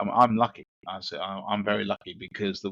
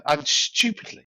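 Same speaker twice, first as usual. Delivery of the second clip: plain reading voice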